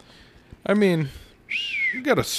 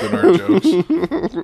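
laughing; 6 semitones higher, 7 dB louder, much slower than usual. Real, not acted. Sarcastic laughing remark.

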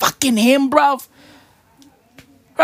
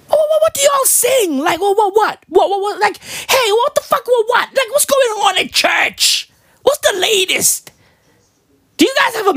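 pitched way up, rapid-fire what-what-what; high excited rant; 10 semitones higher than usual. Acted. Comic demanding what they sing at church, high and frantic. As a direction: yelp it high like you can't believe it.

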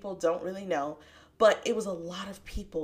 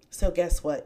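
normal talking voice

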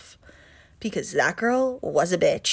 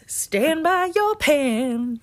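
high, very drawn-out signature opener; old-school TikTok cook; 8 semitones higher, much slower than usual. Retold or imitated. Showing how the cooking lady starts videos, drawn-out high imitation. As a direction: imitate a retro cooking host's catchphrase, slow and high. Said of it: "old school, like, nineties-esque".

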